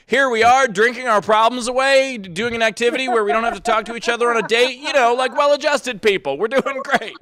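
mock the proud damaged comic; hammed-up boomer comic bragging; hack comic impression. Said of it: "those comics who are just like".

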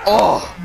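sharp pained shout; hit hard crying out. Real, not acted. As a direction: yelp at the blow. Player hit hard in game.

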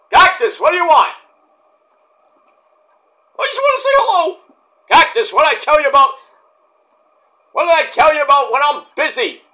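exasperated nagging partner; annoyed Cherry. Acted. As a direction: scold him for bugging you while busy. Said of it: "Mick Foley impersonation".

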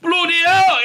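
hammed-up shocked confession; mock exclaiming doctor. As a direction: blurt it out like an exasperated doctor. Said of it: "the doctor will be like".

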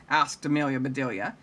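ordinary delivery, nothing marked

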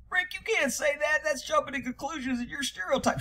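high, soft whiny voice mocking the critics. Mocking the online critics in a whiny voice. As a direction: sneer it like a know-it-all critic.

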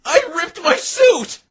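high, loud, slow wail of dismay; distraught. Acted. Detective discovering her torn suit, distraught. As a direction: mourn the ripped suit.

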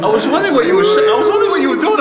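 high, fast giggling words; laughing. Real, not acted. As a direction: laugh through the question. Laughing at friend's reaction.

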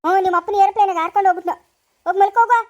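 put-on adult scolding the crying child to go to bed; angry scolding. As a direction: snap at her to go to sleep.

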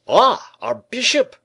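high, loud, slow exclamation; hearty greeting. A narrator's reading. Porthos greets Aramis at the door, exclaiming loudly. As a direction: hail a friend in a big put-on voice. Porthos hails his arriving friend.

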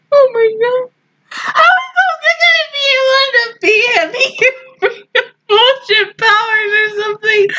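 high, wailing protest; distraught crying. Real, not acted. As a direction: wail the complaint through tears. Crying over the hero's hopeless fight.

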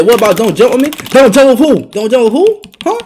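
loud, indignant comeback; loud taunt. Real, not acted. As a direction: throw the words back loudly. Throwing opponent's words back.

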